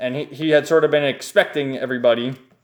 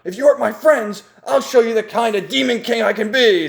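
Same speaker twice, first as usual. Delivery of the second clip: high, declaiming anime-hero voice; hammed-up battle challenge; heroic threat; 7 semitones higher than usual.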